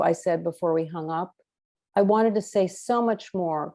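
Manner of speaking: usual speaking voice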